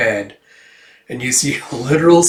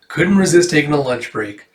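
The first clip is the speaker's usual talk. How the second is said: ordinary talk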